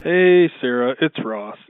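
normal talking voice

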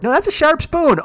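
squeaky dumb model protest; ditzy model voice. Retold or imitated.

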